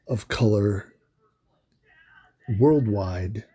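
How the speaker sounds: holding back tears, halting; emotional, voice catching between words; choked up. Real, not acted. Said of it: "it's emotional".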